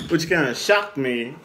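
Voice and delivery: ordinary talk, nothing marked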